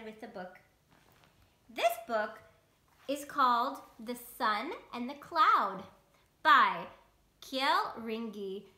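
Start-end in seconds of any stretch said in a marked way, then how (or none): none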